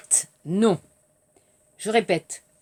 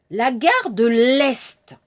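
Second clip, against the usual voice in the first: slow teacher model; slower than usual. Shown as a demonstration. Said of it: "Je répète".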